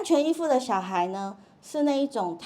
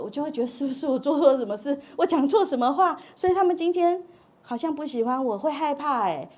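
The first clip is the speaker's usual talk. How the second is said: anxious rush; 6 semitones higher, 6 dB louder than usual. Retold or imitated. Anxious girl recalling her fears.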